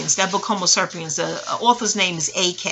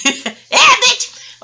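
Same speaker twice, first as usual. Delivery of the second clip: laughing shout; 9 dB louder than usual. Real, not acted. Laughing outburst at own joke.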